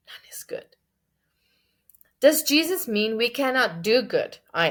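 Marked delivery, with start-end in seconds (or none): 0.1-0.8 whispered, much softer; hushed repeat. Acted. Preacher pondering the verse just read, whispering. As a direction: drop to a bare whisper.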